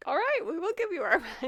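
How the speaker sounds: high, fast and laughing; laughing eagerly. Retold or imitated. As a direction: gush it out laughing. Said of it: "they're like".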